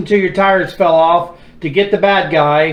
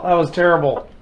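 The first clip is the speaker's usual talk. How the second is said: ordinary talk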